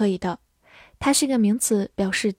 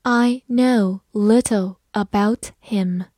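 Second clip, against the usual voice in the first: slow, careful reading for learners; slow classroom read-aloud; slowly; slower than usual. Shown as a demonstration. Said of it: "好，慢慢来读。".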